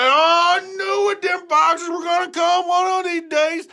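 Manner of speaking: high, loud shout; high farmer charging out; excited farmer. Acted. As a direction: holler with eager glee.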